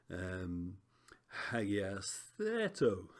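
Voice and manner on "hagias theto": slow sing-song "hagias theto", "theto" pitched up then falling; exaggerated pitch accent. Shown as a demonstration. Teacher demonstrating Greek accents for students, exaggerated pitch accent. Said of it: "they think is rising".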